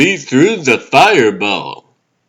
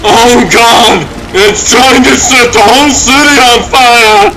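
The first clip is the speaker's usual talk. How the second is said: louder, higher panicked yell; panicked shout; 7 semitones higher, 4 dB louder than usual. Acted. Pilot shouting to the station as the city burns. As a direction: shout it over the roar of the flames.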